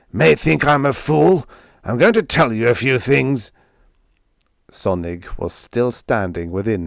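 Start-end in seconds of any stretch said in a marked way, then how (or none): 0.1-3.5 louder, higher, forceful rant; angry tirade. A narrator's reading. Jealous man berates her behind a closed door, angry tirade. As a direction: launch into an indignant tirade. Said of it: "Narf's muffled tirade began".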